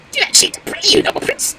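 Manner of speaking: high, loud squeaky bird plea; high-pitched imploring bird voice; pleading wild duck. Acted. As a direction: beg as a small frightened duck.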